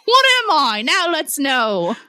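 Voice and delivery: high, demanding, badly faked British accent; horrible British accent. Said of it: "forgive me for this horrible accent".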